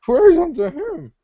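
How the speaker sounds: high, slow, hammed-up proclamation; mock solemn cartoon voice. Joking friend proclaiming in character, high and slow.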